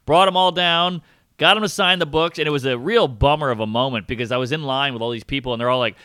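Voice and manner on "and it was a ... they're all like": normal talking voice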